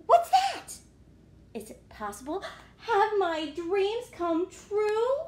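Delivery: high, loud, then slow wonder; amazed pigeon. Acted. Pigeon hearing the puppy arrive, amazed. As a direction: marvel at the sound.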